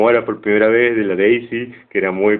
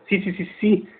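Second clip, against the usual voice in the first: sobrino ansioso; 9 semitones higher, 5 dB quieter than usual. Retold or imitated. Sobrino entusiasta.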